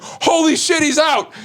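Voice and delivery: imitated high-pitched panicked exclamation; alarmed cry. Storyteller acts out pranksters seeing him escape, high mock alarm. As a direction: act out startled pranksters, voice jumping up.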